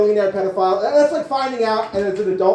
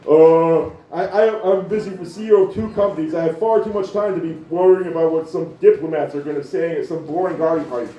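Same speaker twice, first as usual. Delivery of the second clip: lightly voiced nerdy billionaire, low and halting; awkward Musk imitation; 4 semitones lower than usual. Comic reading Musk's email reply as an awkward, halting nerd. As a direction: mumble it like a socially inept tech boss.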